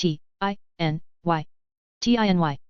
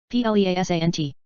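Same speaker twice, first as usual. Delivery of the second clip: letters rattled off fast; quick spelling; faster than usual. Shown as a demonstration. Teacher spelling the word fast for the class. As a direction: run through the letters briskly. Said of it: "spelling drill".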